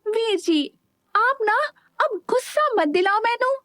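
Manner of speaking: put-on high, irritated girl's voice. A narrator's reading. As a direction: snap at him in a huff. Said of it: "चिढ़कर बोली".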